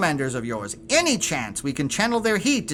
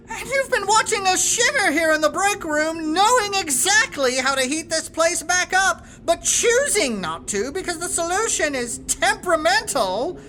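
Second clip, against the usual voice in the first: exasperated; 7 semitones higher than usual. Real, not acted. Boss exasperated at Abraxas.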